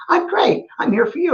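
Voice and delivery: high, chirpy woman voice; Jordy voice. Retold or imitated. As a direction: voice Jordy brightly. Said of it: "She goes".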